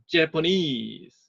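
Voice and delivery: Japanese very slow, NESE stressed. Shown as a demonstration. Teacher showing how the stress moves to NESE. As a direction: stretch out Japanese, land on NESE. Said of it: "강세가 바뀝니다".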